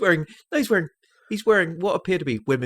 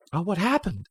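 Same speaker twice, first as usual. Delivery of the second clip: lightly voiced wife, higher pitch; film wife; 8 semitones higher, 3 dB quieter than usual. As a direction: play the wife asking, lifted voice. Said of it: "and his wife goes".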